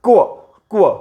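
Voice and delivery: क्व as "kwa", k gliding into w; short separate क्व, repeated; phonics sound of Q. Shown as a demonstration. Teacher giving the sound of Q, क्व as kwa. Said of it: "क्यूचा उच्चार होतोय".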